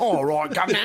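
laughing through a high mock British voice; British accent. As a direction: chuckle out a cockney greeting. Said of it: "She's like".

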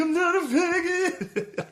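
high, slow, drawn-out wail; hammed-up whine back at dad; mock tearful protest. Retold tearful protest to father.

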